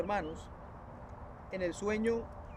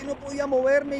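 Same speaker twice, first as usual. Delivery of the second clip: ordinary delivery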